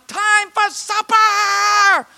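high, drawn-out calling holler; long, slow cry held on a high note. Retold or imitated. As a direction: sing it out like a mother's supper call. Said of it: "is crying out".

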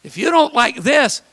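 normal talking voice

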